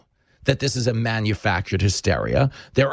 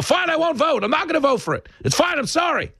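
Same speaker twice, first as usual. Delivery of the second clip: high-pitched mock surrender; exasperated giving in. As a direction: cave in, voice pitched way up. Acted average person giving in.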